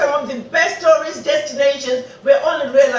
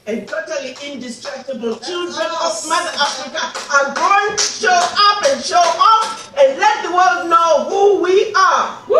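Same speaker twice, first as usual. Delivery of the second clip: rousing shout; 6 semitones higher, 5 dB louder than usual. Real, not acted. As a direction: lift the crowd to a triumphant shout. Rousing speech climax.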